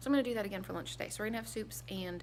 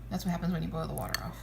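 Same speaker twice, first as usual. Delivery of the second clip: normal talking voice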